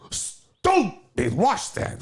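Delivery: high, slow cry of pain and anger; pained shout. Acted. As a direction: curse the washstand. Pain after punching washstand.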